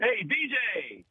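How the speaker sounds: high, drawn-out call from afar; imitated shout. Guy hailing comic across pizza joint, imitated shout. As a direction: mimic a fan hollering across a room. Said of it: "some guy shouts".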